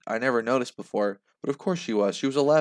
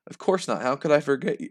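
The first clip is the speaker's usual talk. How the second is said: usual speaking voice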